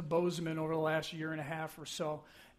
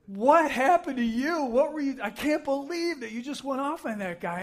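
higher-pitched, incredulous mock outcry; acted-out disbelief, raised pitch; retold shock; 6 semitones higher, 4 dB louder than usual. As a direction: imitate stunned passengers scolding the driver. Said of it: "three people shocked at what just happened".

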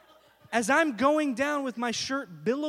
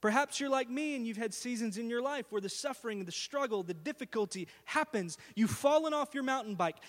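usual speaking voice

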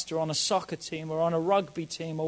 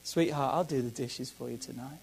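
soft, lower, gentle offer; tender; 7 semitones lower, 9 dB quieter than usual. Shown as a demonstration. Husband to wife, gently offering to do dishes. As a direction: soften it right down, all love. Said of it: "in a different tone".

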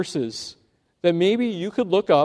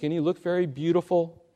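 usual speaking voice

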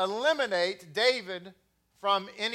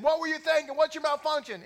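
higher, punchy mock drill sergeant; drill instructor bark; 7 semitones higher than usual. Drill instructor grilling a recruit, mock barked. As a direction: snap the questions like a drill instructor. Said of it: "if they said".

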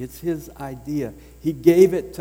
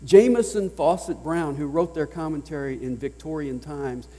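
ordinary talk, nothing marked

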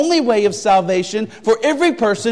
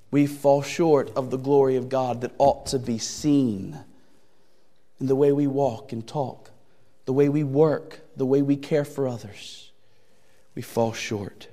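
quiet earnest sermon; 7 semitones lower, 7 dB quieter than usual. Real, not acted. Preacher drops to quiet intensity.

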